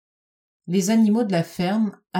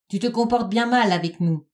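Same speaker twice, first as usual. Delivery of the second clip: slightly raised, squawky scolding; screechy rooster voice; 3 semitones higher than usual. A narrator's reading. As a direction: lecture like an arrogant rooster. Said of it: "de sa voix criarde". (voix criarde de coq)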